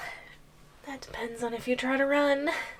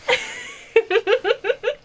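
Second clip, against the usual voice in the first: a bright, high delighted giggle, loud; high gleeful giggle; 13 semitones higher, 7 dB louder than usual. Acted. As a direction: giggle with delight at his fear. Delighted at captive's fear.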